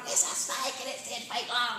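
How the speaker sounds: mock panic, voice way up high; retelling a brother's hysterical fright; panicked shriek. Panicked brother retold in story.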